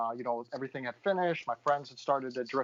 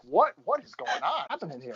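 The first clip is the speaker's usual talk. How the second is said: higher, baffled questioning; confused attacker; 4 semitones higher than usual. Retold or imitated. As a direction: act out a totally confused mugger. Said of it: "he's totally confused".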